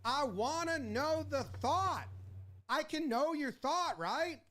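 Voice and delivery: mock indignant manager; insistent manager demand. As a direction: insist on hearing their reasoning. Manager voiced demanding explanation.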